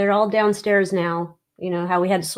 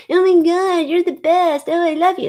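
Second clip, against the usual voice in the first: high, loud mock adoration; gushing suck-up student; 11 semitones higher, 6 dB louder than usual. Imitating students kissing the teacher's ass, mock gushing. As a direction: gush like a fawning teacher's pet. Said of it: "students kissing this teacher's ass".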